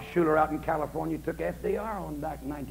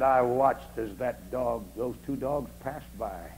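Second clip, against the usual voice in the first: slow relish; 4 semitones lower, much slower than usual. Retold or imitated. Savouring story moment.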